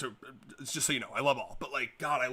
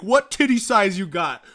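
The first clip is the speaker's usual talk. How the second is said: mock loud question; 3 semitones higher, 10 dB louder than usual. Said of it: "be like".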